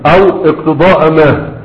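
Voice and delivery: ordinary talk, nothing marked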